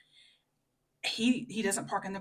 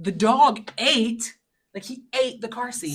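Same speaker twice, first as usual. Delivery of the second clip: insistent; 5 semitones higher, 8 dB louder than usual. Retold or imitated. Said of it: "I'm like".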